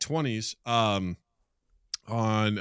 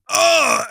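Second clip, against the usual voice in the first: sharp high mock groan; pained ugh; 11 dB louder than usual. Senator flicked mid-filibuster, high pained ugh. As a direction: yelp as if flicked.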